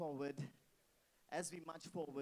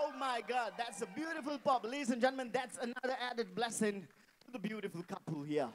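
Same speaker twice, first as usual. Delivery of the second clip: usual speaking voice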